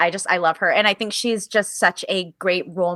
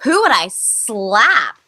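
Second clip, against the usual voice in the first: slow stretched-out question, louder; slow, drawn-out repeat; 2 semitones higher, 5 dB louder, much slower than usual. Real, not acted. Taking the interviewer's question seriously, slow stretched-out repeat. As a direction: drag it out as you finally decide.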